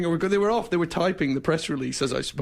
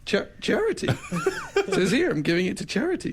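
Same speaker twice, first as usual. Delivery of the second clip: insistent, laughing; 10 semitones higher than usual. Retold or imitated. Host insisting to the racer it says charity, high and laughing. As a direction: push the paper at him, laughing. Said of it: "I said".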